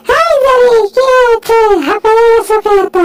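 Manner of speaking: high, quick cartoon voice asking after a friend; chirpy Tom checking on Jerry; high cheerful cartoon cat.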